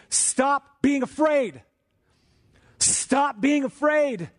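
high, slow "Stop being afraid", each word weighted; "Stop being afraid" spaced out at high pitch; doubly emphatic command. Acted. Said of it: "it's doubly emphatic".

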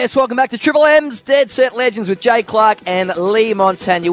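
loud, high announcer intro; big radio intro. Retold or imitated. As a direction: boom it out like a race-day host. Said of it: "when you're doing the big intro".

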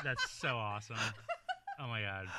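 soft laughter through the words; kind gentle laugh. Real, not acted. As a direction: laugh kindly, quietly. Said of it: "I'm not laughing at him".

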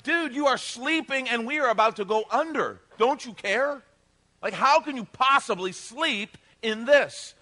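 high, loud incredulous complaint; loud, indignant disbelief; exasperated protest. Retold or imitated. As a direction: imitate panicked friends scolding a sleeper. Disciples' exasperated protest to sleeper.

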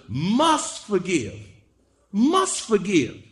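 "must" pitched high and stretched slowly; stress on must. Real, not acted. Preacher insists it didn't say should, punching "must" high. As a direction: hit "must" hard, both times. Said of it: "didn't say should".

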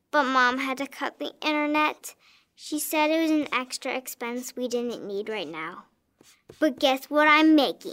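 ordinary talk, nothing marked

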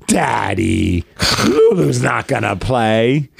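put-on slow, drawn-out child's reproach; mock-disapproving little girl, slow; imitating scolding daughter. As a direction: set Dad straight, stretching each word. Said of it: "she's like".

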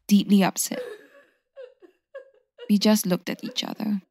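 ordinary delivery

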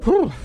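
shivery whew. Acted. Ad, coming in from cold.

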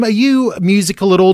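usual speaking voice

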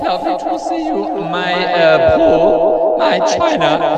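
slow, distorted celebrity DJ greeting; garbled put-on accent, drawn out; odd character voice. Visiting DJ greeting the radio hosts, odd character voice. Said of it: "do you actually speak like this".

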